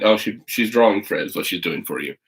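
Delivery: ordinary delivery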